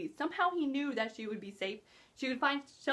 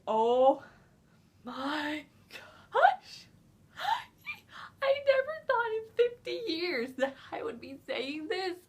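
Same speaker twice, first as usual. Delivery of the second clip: higher, whimpering half-sob; mock crying; 6 semitones higher, 4 dB louder, much slower than usual. Real, not acted. Reviewer grieving a favourite character, mock sob. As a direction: whine it like you're about to cry.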